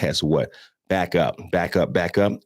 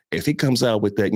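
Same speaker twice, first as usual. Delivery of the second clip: usual speaking voice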